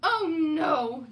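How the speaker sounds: loud high moan of despair. Acted. George moaning over his lost last tooth. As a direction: wail it like all is lost. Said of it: "George moaned".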